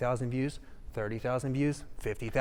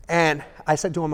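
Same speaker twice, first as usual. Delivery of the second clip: usual speaking voice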